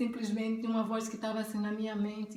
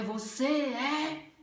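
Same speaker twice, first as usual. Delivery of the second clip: higher, very slow and stretched-out declaration; authoritative voice of God; 5 semitones higher, 3 dB louder, much slower than usual. Retold or imitated. God to a girl who is not listening, slow and authoritative. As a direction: draw each word out with commanding weight. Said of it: "ele falou também com autoridade".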